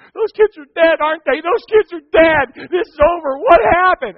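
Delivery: high, loud, mock-panicked pleading; imitating panicked girls. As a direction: ham up terrified teenage girls. Frightened girls voiced in story.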